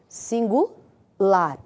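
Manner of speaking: "Singular" very slow, broken before "lar"; syllables split. Shown as a demonstration. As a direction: draw out "Singu", pause, then land "lar". Teacher spells out answer slowly.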